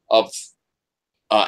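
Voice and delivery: normal talking voice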